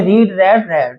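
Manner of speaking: रीड as reed then रेड as red; read forms. Shown as a demonstration. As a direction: contrast रीड and रेड, stressing each vowel. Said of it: "रीड की form क्या होते हैं".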